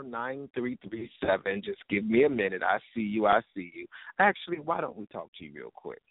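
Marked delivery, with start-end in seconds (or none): none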